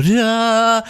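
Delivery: high, loud sung-out burst; imitated music swell. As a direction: mimic a triumphant soundtrack sting. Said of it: "then the music's gonna change".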